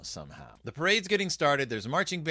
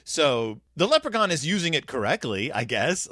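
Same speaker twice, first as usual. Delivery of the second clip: ordinary talk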